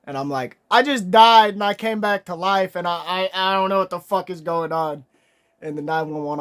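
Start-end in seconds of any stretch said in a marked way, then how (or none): 0.7-5.0 loud, high, frantic rush. Retold or imitated. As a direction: blurt it at the operator in a panic. Frantic call to nine one one.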